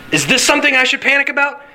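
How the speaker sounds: high, quick worried question; mock pre-panic. Worrier eyeing everything as a threat, mock pre-panic. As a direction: ask it fast and high, playing a worrier. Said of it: "of pre-panic".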